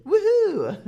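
high, loud joyful whoop; excited cheer. Shown as a demonstration. Teacher cheers to show happiness to the class. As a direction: cheer it out like you won. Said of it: "als letztes haben wir glücklich".

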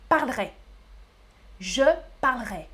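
"parlerai" clipped to "parl-ré"; "parlerai" the Paris way; Parisian pronunciation. Shown as a demonstration. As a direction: drop the e in "parlerai". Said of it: "C'est comme ça qu'on prononce dans la région de Paris".

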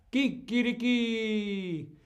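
high, slow rooster call; rooster crow. Shown as a demonstration. Teacher imitating a rooster crow for the class. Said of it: "en España el gallo canta".

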